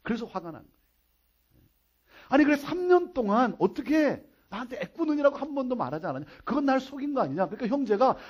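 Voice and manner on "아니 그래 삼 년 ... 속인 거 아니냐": higher-pitched, aggrieved confrontation; acted indignant outburst; indignant accusation. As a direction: confront him as if you were deceived. Acted quarrel, woman confronting man.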